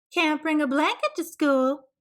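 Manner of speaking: high, prim neighbour voice; a busybody's high voice; nosy neighbour voice. A narrator's reading.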